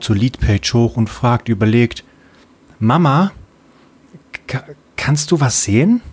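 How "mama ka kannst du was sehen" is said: put-on high, bright young girl's voice; little child voice. Little Freebie replying to her mother, high childlike voice. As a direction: play a sleepy little kid answering mom.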